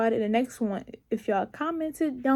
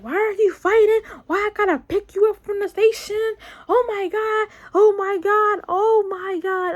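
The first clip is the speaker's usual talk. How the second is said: mad mom; 7 semitones higher than usual. Retold or imitated. Imitating her mom yelling at her in the car. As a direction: scold like a mom fed up and near tears. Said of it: "She was mad as fuck".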